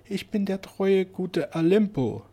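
high, slow, plaintive wail; lamenting. Acted. Madman lamenting behind the locked door, slow and plaintive. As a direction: mourn it like a lost madman. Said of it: "die klagenden Worte".

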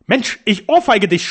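loud, high and slow threat; furious shout. A narrator's reading. Alfonso threatening to slap the doctor, furious shout. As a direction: shout it in rage, drawing the words out. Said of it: "schrie Alfonso wütend".

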